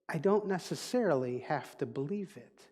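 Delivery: ordinary delivery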